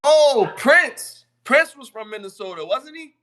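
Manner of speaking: loud, high burst of recognition; sudden excited realization. Real, not acted. Streamer suddenly remembering [name]'s home state, excited. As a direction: blurt it out high and loud as it clicks.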